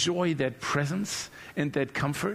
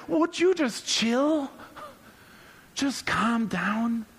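slow appeal; 5 semitones higher, slower than usual. Acted. God telling listener to relax.

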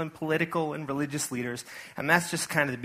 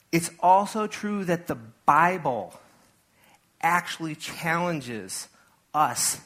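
slowed emphasis; 2 semitones higher, much slower than usual. Real, not acted. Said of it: "slowed for emphasis".

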